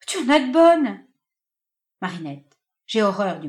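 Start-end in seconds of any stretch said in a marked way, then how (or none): 0.1-1.0 indignant retort. Acted.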